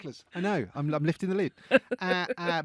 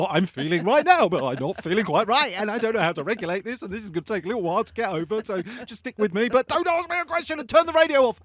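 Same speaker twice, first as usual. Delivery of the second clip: rushing, rising, louder and faster plea; mock overwhelmed rant; 4 semitones higher, 6 dB louder, faster than usual. Driver on bumpy road telling partner, building frantic plea. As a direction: pour it out faster and louder until it peaks.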